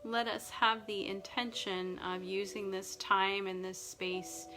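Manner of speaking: ordinary talk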